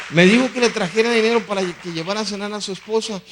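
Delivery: high, loud retold message; forceful, insistent relaying of a message; emphatic repetition. Drunkard's message repeated emphatically.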